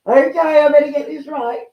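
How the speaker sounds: high, loud burst of nervous excitement; giddy nervous rush; excited. Real, not acted. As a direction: blurt it out, keyed up before the big reveal. Nervous excitement before the reveal.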